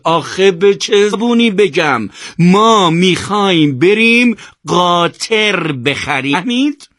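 high, loud yell, words dragged out slowly; slow, emphatic, frustrated yelling; exasperated shout. A narrator's reading. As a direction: shout it word by word at the end of your tether. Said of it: "سرش رو گرفت و فریاد زد".

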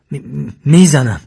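loud, slow stuttered threat; stammering. A narrator's reading. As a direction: stumble on the first syllable, then force out the warning. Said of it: "و با تته پته گفت". (تهدید با تته پته)

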